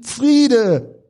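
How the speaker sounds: high, loud joyful call. Pretend. Preacher voicing the congregation calling out, loud high cry. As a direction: cry it out as you reach safety. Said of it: "wir laufen hin und schreien".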